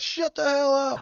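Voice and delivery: high, loud mock holler; mock shouting. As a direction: holler it as if joining a street rant. Mock shouting along with the story.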